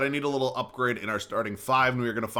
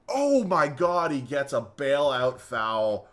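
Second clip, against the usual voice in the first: higher, louder, slow outraged oh my God; a real groan of protest, slowed way down; exasperated disbelief; 4 semitones higher, 4 dB louder, much slower than usual. Real, not acted. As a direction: stretch it out in disbelief at the call. Outraged at a bailout foul.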